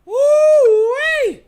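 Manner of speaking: high, loud hollered woo-wee; big excited shout. Real, not acted. Hostess opening the gossip with a loud high holler. Hostess introducing the drama news.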